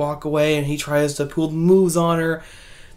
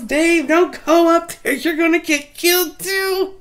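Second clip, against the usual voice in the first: put-on much higher, urgent little-kid warning; 11 semitones higher, 4 dB louder than usual. Kid warns Dave not to go upstairs, high and urgent. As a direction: warn him like a scared child. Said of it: "And he goes".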